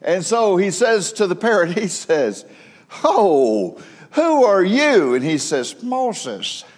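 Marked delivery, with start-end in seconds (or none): 3.0-5.1 startled burglar. Retold or imitated. 5.8-6.6 parrot voice. Retold or imitated. Parrot answers the burglar.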